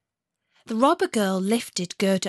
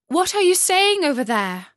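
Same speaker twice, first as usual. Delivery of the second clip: high, loud call into the dark; cried out; 9 semitones higher, 5 dB louder than usual. A narrator's reading. Gerda cries out to the pigeons, high and loud. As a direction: call out eagerly to the birds. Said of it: "cried Gerda".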